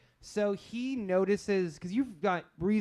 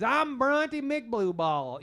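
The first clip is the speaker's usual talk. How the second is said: put-on slow, drawn-out Southern boy voice, pitched up; made-up Southern accent; 7 semitones higher, 3 dB louder, much slower than usual. Game master voicing the NPC introducing himself, proud slow Southern drawl. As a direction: drawl the name out proudly and slowly. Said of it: "in his very weird accent that I just made up".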